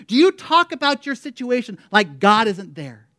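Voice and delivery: loud, emphatic pulpit proclamation; full-force preaching. Real, not acted. Preacher reminding listeners who God is, full-force preaching. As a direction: drive each phrase home to the congregation.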